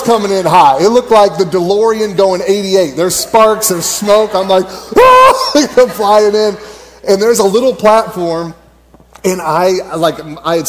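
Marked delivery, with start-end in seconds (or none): 5.0-5.5 sharp, loud, very high yelp; high scream. Retold or imitated. Rider screaming while flying in fast on the zip line, high yelp. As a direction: let out a squeal as you rocket in.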